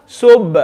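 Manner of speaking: Sub with an audible bounce on b; echoing b. Shown as a demonstration. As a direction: echo out the end of Sub. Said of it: "literally echoing out the sound".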